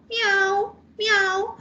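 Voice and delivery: high playful meow like a cat. Shown as a demonstration. Teacher imitating a cat for the class, high meow. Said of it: "imitates the sound meow".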